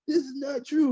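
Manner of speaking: high, insistent correction; emphatic denial. Retold or imitated. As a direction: shut down the claim firmly. Retelling correcting people about Apple.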